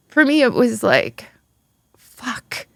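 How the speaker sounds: real upset, fighting back tears; near tears. Real, not acted. Recalling news of her ex's death, near tears. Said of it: "it's gonna make me cry".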